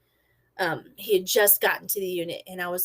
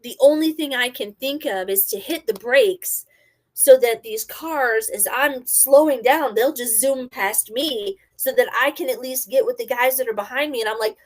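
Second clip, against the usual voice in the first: ordinary delivery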